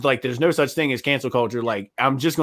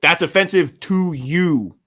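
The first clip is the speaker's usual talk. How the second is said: slow mocking finish; 3 semitones higher, 4 dB louder, slower than usual. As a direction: spell it out slowly to the offended. Mocking the offended.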